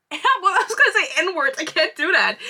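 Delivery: amused protest at your own slip; stifling laughter while talking; laughing. Real, not acted.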